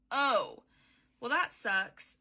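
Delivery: loud, deflated oh well; mock disappointed king. King reacting to the hag's vision, loud and deflated.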